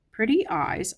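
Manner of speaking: ordinary delivery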